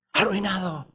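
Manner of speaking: ordinary talk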